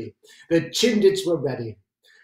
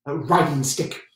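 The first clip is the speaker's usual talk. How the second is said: ordinary talk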